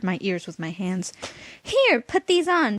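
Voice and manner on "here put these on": shouted. A narrator's reading. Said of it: "America shouted over the noise".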